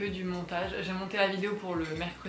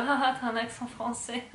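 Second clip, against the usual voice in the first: higher-pitched teasing jeer with laughter; imitated mockery; 4 semitones higher than usual. Vlogger imitating people laughing at her French accent, mocking. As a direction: mimic people making fun, slightly raised pitch. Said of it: "les gens vont dire".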